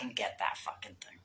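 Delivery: hushed admission of being stoned; whispering he forgot the conch shell; whispered confession. Real, not acted. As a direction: whisper it like a guilty secret.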